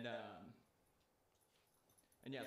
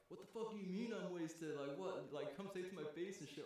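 put-on quoting a drunk friend's challenge, higher pitch; mock angry Sal; 6 semitones higher than usual. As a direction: act out the picked fight, a bit hammed-up. Sal confronting the clerk.

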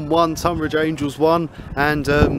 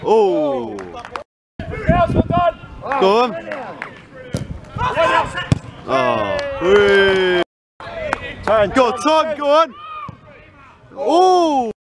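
yelling from the sidelines; 8 semitones higher, slower than usual. Real, not acted. Fan shouting at football match.

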